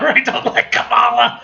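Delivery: laughing, high and loud admission. Real, not acted. Confessing to viewers about Kamala, laughing loudly. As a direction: break up laughing through the words. Cracks up admitting dislike of heroine.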